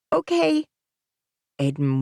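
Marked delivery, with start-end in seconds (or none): 0.1-0.7 put-on young boy. Aiden's child voice.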